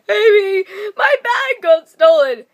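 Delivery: high, loud sob-laden wail; crying to a friend. Retold or imitated. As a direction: wail it out through tears. Crying to her trainer on phone.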